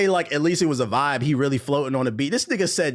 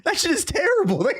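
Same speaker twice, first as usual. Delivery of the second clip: incredulous rant; 11 semitones higher than usual. Real, not acted. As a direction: rant in outraged disbelief. Mocking the Aladdin bar.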